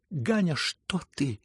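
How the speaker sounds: high, slow plea; alarmed cry. A narrator's reading. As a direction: call out to stop your son. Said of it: "крикнул Нина Александровна".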